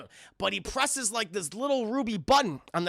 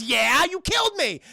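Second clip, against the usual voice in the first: mock indignation; 6 semitones higher, 4 dB louder than usual. Indignant retort acted out.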